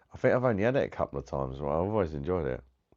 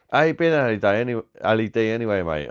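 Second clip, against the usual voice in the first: loud cheery hello; 4 semitones higher, 6 dB louder than usual. Real, not acted. Cheery hello to returning friend.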